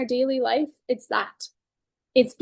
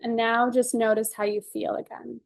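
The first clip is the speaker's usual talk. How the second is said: calm, measured guided-session voice; guided tapping. Acted. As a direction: talk them gently through each tapping point.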